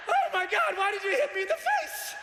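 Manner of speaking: high shocked yell; shocked outcry. Retold or imitated. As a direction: protest mid-fall. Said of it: "I was like".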